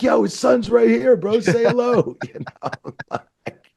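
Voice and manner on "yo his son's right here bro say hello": loud, very high retold plea; urging callout. Wrestler urging friends to greet the son, exasperated. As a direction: call out over a starstruck crowd. Calling out to the starstruck boys.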